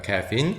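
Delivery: "caffeine" with open "a" in ka, then "feen"; English pronunciation. Shown as a demonstration. Teacher correcting the loanword, English "caffeine" in two syllables. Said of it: "caffeine이라고 합니다".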